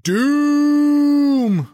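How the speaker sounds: long, high, loud cry of doom. Shown as a demonstration. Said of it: "Let's read it with more oomph".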